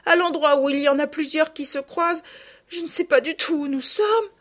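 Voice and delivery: high, loud distraught explanation; tearful outcry. A narrator's reading. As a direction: lament as a crying child. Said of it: "s'écria Sophie en sanglotant". (fillette désespérée, en sanglots)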